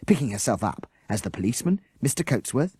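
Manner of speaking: usual speaking voice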